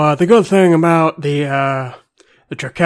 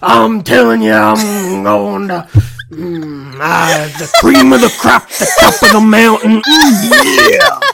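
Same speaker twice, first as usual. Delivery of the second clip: raspy, much higher, louder, laughing promo shout; Macho Man impression; 7 semitones higher, 6 dB louder, slower than usual. Shown as a demonstration. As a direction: ham up the wrestler promo, cracking up. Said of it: "What's Macho Man sound like".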